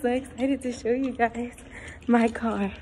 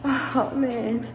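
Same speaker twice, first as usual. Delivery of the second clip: ordinary talk, nothing marked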